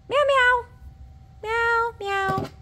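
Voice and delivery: high squeaky kitten meows; cat meows. Pretend. Showing viewers a kitty book, high playful meows. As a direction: meow like a little cat for the book.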